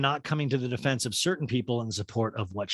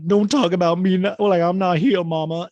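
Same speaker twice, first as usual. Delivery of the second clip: much higher and louder, a daughter protesting in a put-on voice; Julia Roberts quote; 6 semitones higher, 9 dB louder than usual. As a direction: quote the film line loud and high. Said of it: "when Julia Roberts is like".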